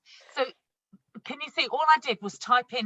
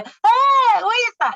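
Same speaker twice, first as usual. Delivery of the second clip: high, loud amazed squeal; surprised customer; 10 dB louder, slower than usual. Retold or imitated. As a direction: act out a guest gasping in surprise. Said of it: "and they go".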